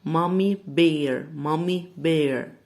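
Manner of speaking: مامي بير said slowly and clearly, each word separate; slow careful demo. Shown as a demonstration. Teacher repeating a name slowly for students. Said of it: "فنقول لها مامي".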